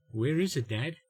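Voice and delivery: usual speaking voice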